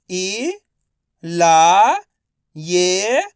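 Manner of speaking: ई, ला, ये each held long; letter-by-letter sounding. Shown as a demonstration. Teacher for pupils to repeat, ई, ला, ये sounded alone. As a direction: stretch ई, ला and ये separately. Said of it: "आपको मेरे साथ साथ repeat करना है".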